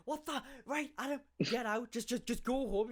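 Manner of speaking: flustered teacher. Retold or imitated. Teacher reacts to the thrown kid.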